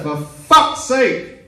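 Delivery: high, loud, drawn-out yell; exasperated shout. Retold or imitated. Girlfriend yelling at shaken boyfriend, exasperated shout. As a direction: bellow it slowly at full exasperation. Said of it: "I've been shouted at by two people".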